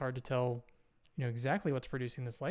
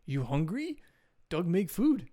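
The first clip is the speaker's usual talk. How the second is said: lightly voiced caveman, raised pitch at the end; a hint of a simple, friendly caveman; caveman Doug; 3 semitones higher, 5 dB louder than usual. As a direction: offer it like a simple cave dweller. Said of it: "and he says".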